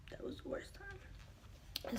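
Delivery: breathy secret whisper. Real, not acted. Whispering to viewers about the worst moment. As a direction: drop to a whisper for the aside.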